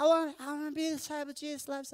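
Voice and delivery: put-on a kid almost wailing to be chosen; desperate child. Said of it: "I was like".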